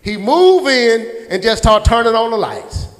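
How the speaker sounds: usual speaking voice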